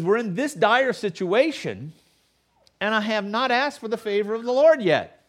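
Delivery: lightly voiced Saul justifying himself; pious excuse. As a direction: make the excuse sound holy.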